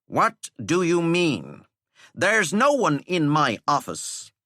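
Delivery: put-on teacher covering for boy; mock-innocent teacher. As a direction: feign puzzlement. Teacher lies smoothly.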